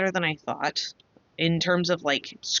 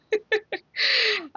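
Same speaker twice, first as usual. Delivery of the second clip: a high, crying-edged laugh. Real, not acted. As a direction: laugh with relief till it cracks. Laughing after thanking universe.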